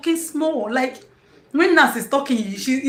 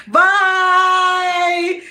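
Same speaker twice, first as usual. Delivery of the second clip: ordinary talk, nothing marked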